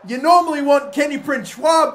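normal talking voice

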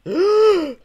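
high, theatrical gasp; shocked gasp. Acted. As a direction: gasp in horror. Hero shocked in script.

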